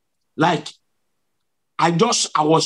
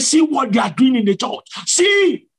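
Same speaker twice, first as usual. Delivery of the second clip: high shouted rebuke, last word leaping higher; angry divine voice; 7 semitones higher than usual. Retold or imitated. Preacher voices Jesus exposing the church, high retold anger. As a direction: imitate an indignant command, rising to a piercing cry. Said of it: "that voice was saying".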